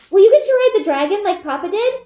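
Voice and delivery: put-on high, bright-eyed little girl asking; little sister Eliza. A narrator's reading. Little sister asking Bryn about becoming a seeker, high childlike voice. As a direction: voice a small excited child, pitched high.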